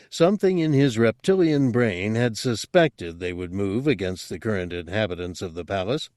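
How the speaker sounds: ordinary delivery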